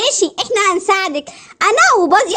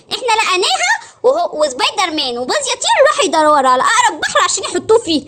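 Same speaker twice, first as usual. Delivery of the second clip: usual speaking voice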